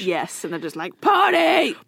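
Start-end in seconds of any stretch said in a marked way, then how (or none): 1.0-1.8 rowdy party shout; frat bro. Pretend. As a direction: yell like a frat boy at a kegger. College kids let off leash.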